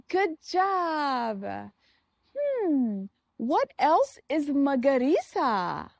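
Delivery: usual speaking voice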